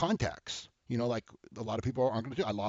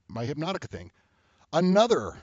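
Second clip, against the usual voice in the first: ordinary talk, nothing marked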